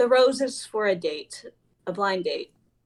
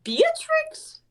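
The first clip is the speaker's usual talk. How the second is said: much higher, stunned "Beatrix?"; as if the name is absurd; incredulous; 3 dB louder than usual. Acted. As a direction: shoot way up in disbelief.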